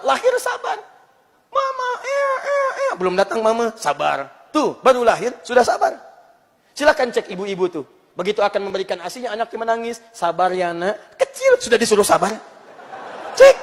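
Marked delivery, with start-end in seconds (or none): 0.1-2.9 put-on very high baby cry voice; hammed-up infant crying for mama; mock newborn wail. 10.1-11.1 put-on slow, gentle hush to a baby; calm the child slowly; a mother soothing her crying baby, slow and gentle.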